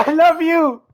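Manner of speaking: high, loud, laughing declaration; over-the-top love cry; hammed-up shout. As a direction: bellow the confession for laughs. Excited reading confession.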